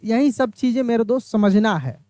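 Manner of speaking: normal talking voice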